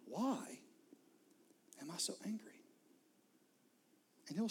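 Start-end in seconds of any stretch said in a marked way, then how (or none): 0.0-2.6 soft whispered question to Jesus; praying under the breath; hushed prayer. Shown as a demonstration. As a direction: ask quietly, intimately. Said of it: "just say".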